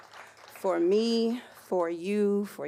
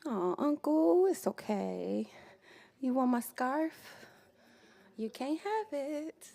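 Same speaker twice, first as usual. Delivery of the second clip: playful teasing voice; 6 semitones higher, slower than usual. Acted. Teasing uncle over scarf.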